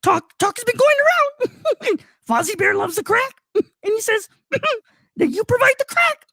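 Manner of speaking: creepy clown calling down the sewer; Pennywise impression. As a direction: lure him in with a creepy high clown voice. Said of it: "Pennywise luring Kermit".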